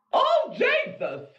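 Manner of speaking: high drawn-out shriek of shock; startled shriek. Real, not acted. Streamer shrieks at Jeff's picture, startled. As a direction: yelp at the scary image.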